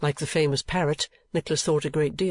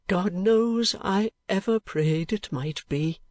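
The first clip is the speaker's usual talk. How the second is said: higher-pitched voice, broken by pauses; old gentleman recalling bereavement; 7 semitones higher, slower than usual. Acted. Old gentleman telling of the dead sisters, regretful. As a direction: recount the loss in measured phrases.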